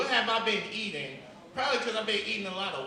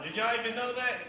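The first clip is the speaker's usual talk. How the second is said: ordinary talk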